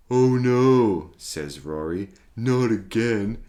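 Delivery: loud sheepish dinosaur. Acted. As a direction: blurt it out sheepishly. Rory apologises.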